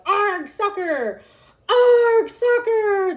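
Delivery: loud, high pirate growl of a cheer; pirates yelling. A narrator's reading. Braidbeard and crew yell about soccer, loud and high. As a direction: holler it after a kick. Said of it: "Braidbeard kicked the ball and yelled".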